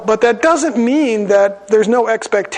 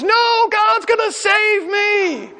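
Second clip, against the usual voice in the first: high, slow shouted refusal; man on the roof shouting down to the pilot; defiant shout; 12 semitones higher, much slower than usual. Acted. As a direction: yell your refusal with pious certainty.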